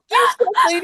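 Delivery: very high, loud squealing shout; bratty shriek. Retold or imitated. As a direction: shriek at her like an outraged kid. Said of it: "And he's just like".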